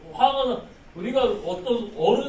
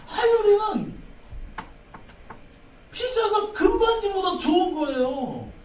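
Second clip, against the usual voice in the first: put-on high, bright little child voice; 11 semitones higher, 5 dB louder than usual. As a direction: speak as the little girl Hayul. Voicing a child's preference.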